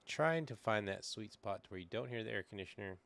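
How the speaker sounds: ordinary talk, nothing marked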